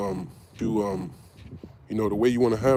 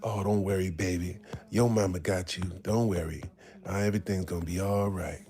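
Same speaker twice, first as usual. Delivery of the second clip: softer, lower soothing mother voice; nurturing mama; 5 semitones lower, 5 dB quieter than usual. Pretend. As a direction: comfort a little child gently. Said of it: "that fucking nurturing mama's boy".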